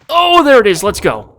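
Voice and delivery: loud, high burst of joy; excited spotting. Real, not acted. Gamer spots the shotgun at last, excited shout. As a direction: shout it as you see the prize.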